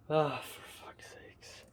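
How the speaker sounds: slow exasperated whisper under the breath; whispered curse. Real, not acted. Annoyed at yawning again, a slow whispered curse.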